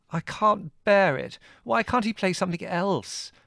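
high-pitched wail of protest. A narrator's reading. Margot unable to bear the record, wailing. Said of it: "Margot wailed".

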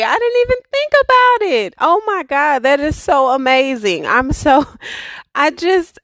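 high, thrilled and surprised; overjoyed sudden realization; excited. Real, not acted. Surprised by five-year anniversary.

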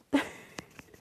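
short breathy laugh, no words; real hushed giggle. Real, not acted. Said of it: "I don't know why I'm laughing".

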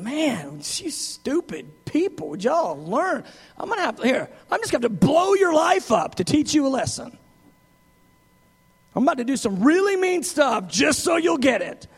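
exasperated God scolding, rising to a shout; hammed-up angry teacher blowing up; mock frustrated teacher. Preacher mocking an impatient God scolding his people. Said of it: "the teacher that just gets frustrated with us".

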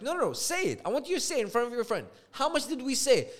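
high, insistent retold challenge; insistent demand. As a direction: press hard for an answer, pitch up. Acted argument over agreed price.

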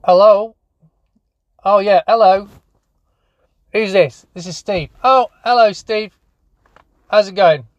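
calls out loudly down a bad phone line; big hearty hello, raising his voice; loud phone greeting. Man calling a supplier about missing toys, loud and hearty.

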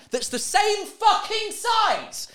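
high, slow, disbelieving; incredulous outrage. Real, not acted. Complaining about the security woman, slow incredulous outrage. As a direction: drag it out in disbelief. Fed up with security's bag rule.

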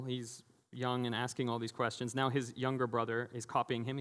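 ordinary talk, nothing marked

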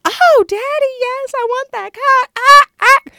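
very high, loud sexual cries; hammed-up squealing moans; loud mock moaning. Acting out loud Skype dirty talk, exaggerated cries. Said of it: "I would have to be la-, loud, like".

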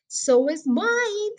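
father savouring the thrill, drawn out; excited agreement. A narrator's reading. Father tells Danny his heart is thumping too, slow and elated. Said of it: "he cried".

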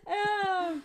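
normal talking voice

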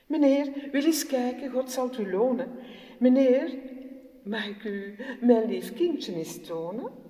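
humble pleading mother. Acted. Mother begs the teenager.

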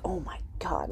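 breathless whispered disbelief; whispered shock. Retold or imitated. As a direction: gasp it under your breath. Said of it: "Jimmy's like".

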